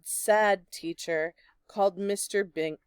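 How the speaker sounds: plain reading voice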